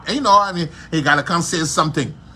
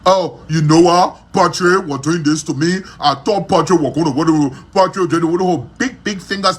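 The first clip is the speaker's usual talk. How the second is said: mock whiny complaint; 3 dB louder than usual. Mocking Karishma complaining about Patrick.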